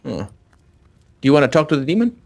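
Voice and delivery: ordinary talk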